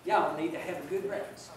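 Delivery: a light, quiet put-on Georgia accent for a little boy; bad Georgia accent. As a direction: speaker imitating a Georgia boy in a mild Georgia accent. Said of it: "I'm gonna massacre a Georgia accent".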